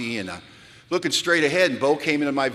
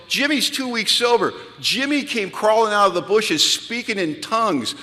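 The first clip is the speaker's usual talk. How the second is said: amazed; 8 semitones higher than usual. Retold or imitated. Amazed at newcomer.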